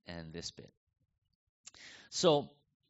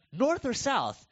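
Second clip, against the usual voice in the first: high, louder pushy question; eager questioner; 11 semitones higher, 6 dB louder, slower than usual. Retold or imitated. A stranger pressing the pastor about Korea, high and eager. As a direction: pounce on it like a nosy stranger.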